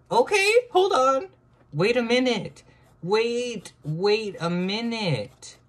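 very high, loud shrieking; excited shriek. Real, not acted. Amazed by her drawing, very high shriek. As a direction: squeal in disbelief.

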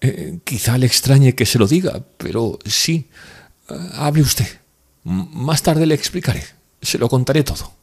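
put-on hesitant, pleading girl; Nastenka's voice.

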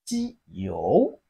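"jiu" dipping low, then rising; third tone. Shown as a demonstration. Teacher models Chinese nine for learners, third-tone "jiu". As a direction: draw out "jiu" from low pitch to a rise. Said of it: "It has the third tone".

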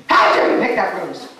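gruff mock-stern voice from behind a bush; a hint of a cranky elf; angry hidden voice. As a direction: scold the princess like a cross little elf. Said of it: "a voice from behind the rose bush said".

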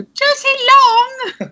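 put-on very high, drawn-out child's call; annoyed daughter. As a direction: imitate a child calling out a parent's full name. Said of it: "like, vaguely annoyed at us".

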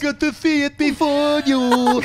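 high mock Swedish brag; bragging Swede voice. To the hosts, mocking Swedes with a Swedish brag. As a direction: sneer like a smug Swede who saw it first. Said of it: "horrible accent".

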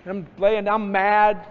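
normal talking voice

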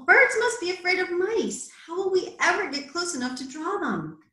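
high-pitched storybook whine; grumpy complaint. A narrator's reading. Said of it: "grumped Mole".

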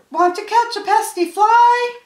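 very high, loud rooster question; shrieky-high storybook rooster voice; crowing rooster. A narrator's reading. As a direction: crow the question, stretching the end. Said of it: "crowed the roaster".

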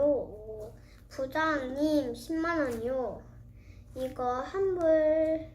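ordinary talk, nothing marked

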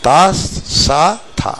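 త, స, థ each alone with a pause; isolated త, స, థ, spaced out; sound by sound. Shown as a demonstration. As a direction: count off త, స, థ separately. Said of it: "అని పలకాలి".

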